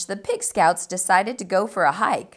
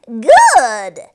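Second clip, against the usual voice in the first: loud cackled "Good"; 9 dB louder than usual. A narrator's reading. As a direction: cackle with satisfaction. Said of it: "cackled the small creature".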